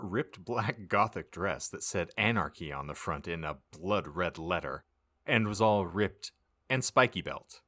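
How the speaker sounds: ordinary talk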